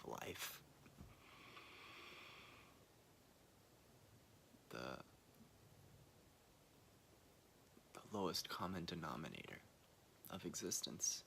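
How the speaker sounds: soft, whispered, reverent; hushed spiritual reflection. Acted. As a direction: breathe it out like a meditation.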